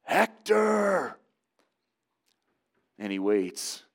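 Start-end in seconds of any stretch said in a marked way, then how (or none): 0.0-1.2 high, drawn-out battle yell; long, high-pitched shout of the name; yelling a challenge. Acted. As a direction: call a warrior out from below the city walls. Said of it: "he just starts to yell".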